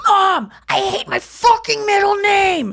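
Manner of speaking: high, slow, loud squawked sentence; parrot mimicking her. The parakeet repeating her words, high slow squawk. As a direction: sound like a bird copying a teenager's rant. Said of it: "in your voice".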